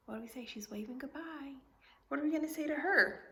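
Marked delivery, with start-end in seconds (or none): 0.1-1.5 soft whispered prompt. Real, not acted. Teacher whispering a prompt to Lily. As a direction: hush and coax the answer. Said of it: "whispered prompt to child".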